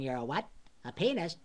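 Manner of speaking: wisecracking cartoon character, rising into the sign-off; quizzical, then a big showman's goodnight; Yakko. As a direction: play the scandalized cartoon wisecracker. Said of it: "Yakko kept saying".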